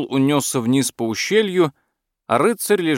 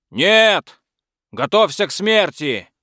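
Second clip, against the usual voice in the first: knight yelling a challenge, higher; defiant shout; 7 semitones higher, 4 dB louder, slower than usual. Acted. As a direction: bellow a battle cry. Knight charging dragon.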